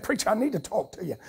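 hushed, weighed-down plea, mildly acted; burdened, troubled. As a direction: softly quote a troubled man asking for help. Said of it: "he was really burdened and troubled in his heart".

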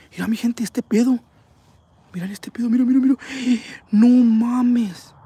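high, gasping astonishment; real shock. Real, not acted. Streamer showing viewers the ghost capture, shocked. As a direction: blurt it out high, gasping at what you see.

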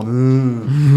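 a closed-mouth "mm" in a voice unlike his own; sulky hum. Retold or imitated. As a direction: hum back at the scolding wife, mock-sulky. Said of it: "le haces como". ("mm" imitado, de mala gana)

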